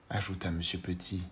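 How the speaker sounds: ordinary delivery, nothing marked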